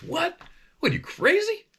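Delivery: high, disbelieving What you crazy; incredulous cop. Retold or imitated. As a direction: squeal it like he can't believe the question. Cop reacts to ride-along request.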